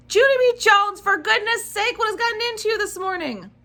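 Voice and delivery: high, loud exasperated scolding; exasperated mother. Acted. Mother scolding Junie B. for waking the baby, exasperated. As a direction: scold her like a frazzled mom.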